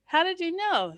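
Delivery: high, pleased question; delighted husband. Retold or imitated. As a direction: act thrilled to be spotted. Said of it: "He goes".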